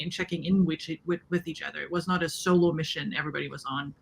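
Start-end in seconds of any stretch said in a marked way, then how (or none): none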